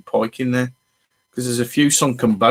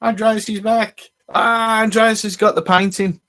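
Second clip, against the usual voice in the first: excited greeting; 9 semitones higher than usual. Real, not acted. Greeting returning viewer.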